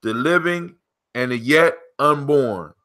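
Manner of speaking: slow, measured proverb reading with pauses; proverb recital. Acted. Host reads the proverb to his co-host, measured recital. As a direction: solemnly recite it, phrase by phrase.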